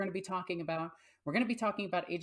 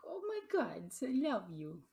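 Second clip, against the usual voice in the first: gushing pet voice; 7 semitones higher than usual. Real, not acted. Adoring her cat mid-intro, high gushing pet voice. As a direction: gush over the cat.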